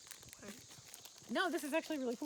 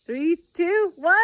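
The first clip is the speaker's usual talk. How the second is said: shouted countdown, louder; 6 semitones higher, 15 dB louder, slower than usual. Real, not acted. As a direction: count them down loud before the jump. Countdown before jumping in.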